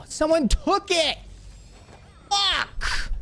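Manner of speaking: very high, drawn-out cry of alarm; frantic outcry. Real, not acted. Gamer sees someone took the item, frantic high outcry. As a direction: cry out high in real dismay.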